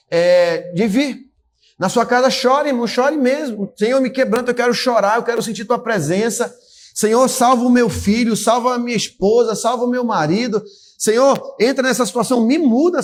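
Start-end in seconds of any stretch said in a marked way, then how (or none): 3.8-13.0 higher, pleading prayer to the Lord; raised praying voice, asking to be broken; fervent prayer. Acted. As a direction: cry out to God for change.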